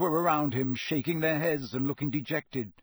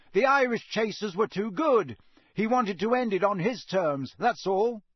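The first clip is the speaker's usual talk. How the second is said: raised, higher-pitched explanation; Harry shouting back; 6 semitones higher, 3 dB louder than usual. A narrator's reading. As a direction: call it over the noise. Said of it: "Harry shouted back over all the noise".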